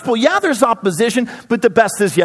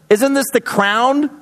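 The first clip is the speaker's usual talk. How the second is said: usual speaking voice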